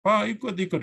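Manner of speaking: lightly imitated child begging to come along; a hint of a high-pitched pestering kid; pleading child. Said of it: "capek, ganggu, narik-narik kita".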